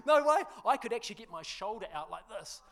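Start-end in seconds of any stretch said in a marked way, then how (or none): 0.1-0.4 high, loud laughing no way; laughing disbelief. Retold or imitated. Pastor upside down on the ride, high laughing disbelief. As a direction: blurt it out half-laughing in mock terror. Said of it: "I'm like".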